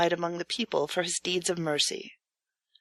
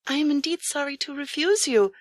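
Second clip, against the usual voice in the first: gentle woman's voice; 6 semitones higher than usual. A narrator's reading. Wife turns the stranger away, lightly voiced higher. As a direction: lift the voice into the wife's, kind but firm. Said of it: "answered the woman".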